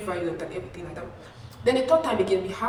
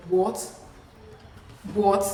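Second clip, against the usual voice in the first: angry; 5 dB louder than usual. Retold or imitated. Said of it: "And she gets angry".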